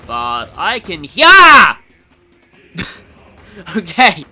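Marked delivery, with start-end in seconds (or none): 1.1-1.8 sudden startled yell, loud and high; real jump scare, yell out in fright; jump scare yelp. Real, not acted. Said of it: "that legitimately jump scared me". 2.8-4.2 nervous laugh. Real, not acted. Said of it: "that legitimately jump scared me".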